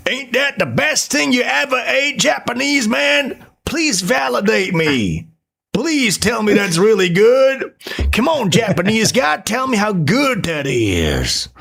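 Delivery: hammed-up American fishing for praise; needy American. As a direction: beg for validation, whining up high. American begging for approval.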